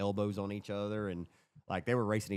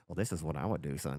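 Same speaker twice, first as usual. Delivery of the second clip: confiding server; 7 semitones lower than usual. Retold or imitated. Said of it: "like, leaned into me and stuff".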